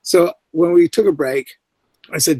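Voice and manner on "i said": ordinary delivery, nothing marked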